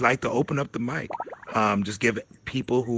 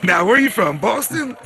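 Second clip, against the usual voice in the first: a giggling guess; laughing question; 10 semitones higher, 7 dB louder than usual. Real, not acted. Host guesses where the caller is from, laughing. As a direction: ask it high, laughing through the word.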